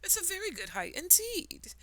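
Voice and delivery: insulted. Acted. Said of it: "The caterpillar was insulted".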